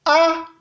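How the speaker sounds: A held on a high, level tone. Shown as a demonstration. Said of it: "le ton haut". (« A » au ton haut)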